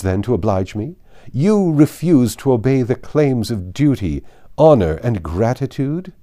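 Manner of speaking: a hint of an affronted aristocrat hammering her points; Lady Catherine.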